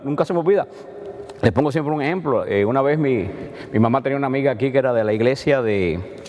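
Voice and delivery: ordinary delivery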